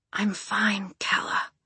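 weak, breathy and quiet; barely any voice behind it. Acted. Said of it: "Pisces said in a breathy voice".

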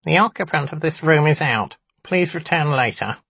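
high, squeaky little imp; squeaky nasal voice. A narrator's reading. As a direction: squeak it out through the nose. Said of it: "spoke in a squeaky nasal voice".

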